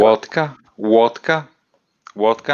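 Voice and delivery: Russian accent, wotka with a w. Shown as a demonstration. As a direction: chant vodka like a Russian. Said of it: "a Russian accent".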